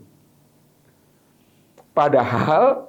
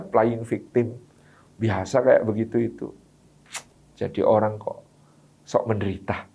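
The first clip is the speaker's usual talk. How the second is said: dismissive comment; 5 semitones lower than usual. Retold or imitated. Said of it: "kita akan komentar ya".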